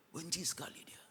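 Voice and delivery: whispered with quiet venom; whispering a grudge; hateful whisper. Acted. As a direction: breathe the hatred out under your breath. Voicing a resentful person.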